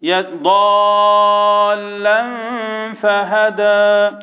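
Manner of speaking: slow chanted recitation, the alif of "ضالا" held long; Quran recitation, long madd. Shown as a demonstration. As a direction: hold "ضالا" six counts then "فهدى". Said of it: "مد لازم كلمي مثقل". (ترتيل بمد لازم في ضالا)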